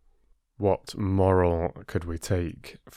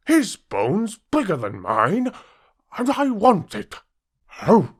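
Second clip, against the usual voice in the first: much higher, louder envious dog voice rising to a yelp; greedy dog; 11 semitones higher, 5 dB louder than usual. Acted. Greedy dog covets the bigger bone, high rising envious whine. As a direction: whine it then snap out the oh. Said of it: "he thought".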